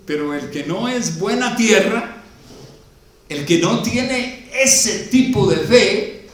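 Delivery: loud, high, slow sermon climax; full-force preaching. Acted. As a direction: preach it out to the whole church.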